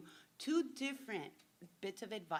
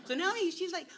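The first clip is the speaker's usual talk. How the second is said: normal talking voice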